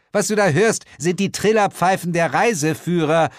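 high, loud woman's voice; impatient explaining. Acted. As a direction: set the whiner straight.

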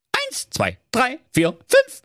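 high counting, last number squeaks up; kids counting. Acted. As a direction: count off like little goats. Begannen erneut zu zählen.

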